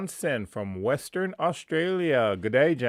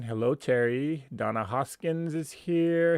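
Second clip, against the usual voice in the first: normal talking voice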